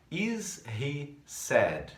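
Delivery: "Is he sad?" drawn out very slowly; slow model question. Shown as a demonstration. Teacher reading the workbook question very slowly to students. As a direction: stretch every word for learners. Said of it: "a primeira delas lá".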